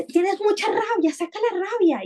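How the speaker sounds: high, cartoonish bear pushing him to let anger out; blustery bear. Bear in the picture book telling the boy to release his rage, high and cartoonish. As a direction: voice a storybook bear egging him on. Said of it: "llega un oso y le dice".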